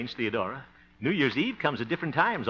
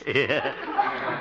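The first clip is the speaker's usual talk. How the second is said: ordinary talk, nothing marked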